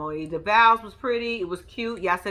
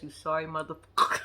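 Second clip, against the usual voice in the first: imitating Ike; 7 semitones lower, 5 dB quieter than usual. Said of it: "Ike said it best".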